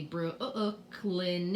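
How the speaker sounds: "Brooklyn" broken up slowly with repeated sounds; wrong doubled sound. Shown as a demonstration. Teacher showing a silly misreading of a name, sounds repeated. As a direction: stretch and stutter "Brooklyn" into extra syllables. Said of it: "We don't say".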